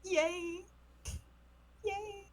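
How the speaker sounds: soft, high little cheers. Acted. Cheering after reunion.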